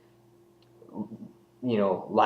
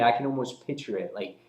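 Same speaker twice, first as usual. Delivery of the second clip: ordinary talk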